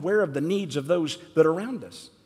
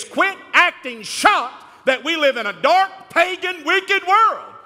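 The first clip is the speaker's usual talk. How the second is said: high, loud, fired-up preaching; preaching at full force; 6 semitones higher, 4 dB louder than usual. Real, not acted. Pastor rails at his flock over the halftime show, preaching at full force. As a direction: thunder it at the congregation like a revival preacher. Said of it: "I just had to get a little preaching out today".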